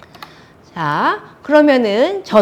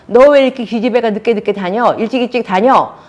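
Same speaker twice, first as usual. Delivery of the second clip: raised, loud scolding; fault-finding mother; 4 semitones higher, 6 dB louder than usual. Retold or imitated. Mother to daughter who comes home late, fault-finding. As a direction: nag hard like an angry mom. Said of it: "지적질이 있고".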